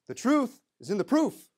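proof lifted high at the end of the rhyme; rhyme proclaimed. Shown as a demonstration. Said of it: "I've got a little rhyme for you".

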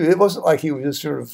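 usual speaking voice

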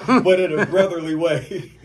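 words broken up by real laughter; laughing while talking. Real, not acted. Telling the story, laughing through it. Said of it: "without laughing".

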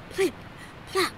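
ordinary delivery

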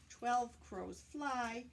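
ordinary delivery

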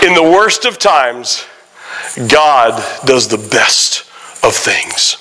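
slowed, each word weighted, dropping low at the end; slow emphatic refrain. Shown as a demonstration. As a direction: spell it out slowly so it lands. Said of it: "repeated refrain slower".

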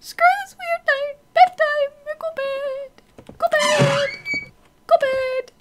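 put-on high squeaky childish whine. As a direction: squeak it like a scared little kid. Fleeing to bed in fear.

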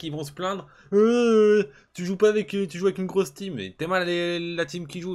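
tirade aiguë et forte; s'emporte, voix qui grimpe; furieux. Real, not acted. Rage contre l'adversaire en ligne. (rage contre l'adversaire)

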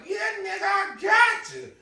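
high, loud shouted bug voice, gloating; a roach crowing over the stolen wallet; taunting roach. Acted.

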